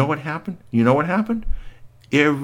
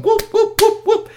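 truck whooping; 4 dB louder than usual. Acted. Truck clapping its hoods.

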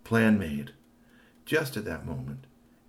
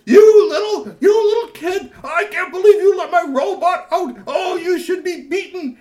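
screeching high furious scream, much louder; furious yell; 18 dB louder than usual. Acted. Robot maker screams at the boy who let the robot out, furious. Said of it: "started yelling at Timmy".

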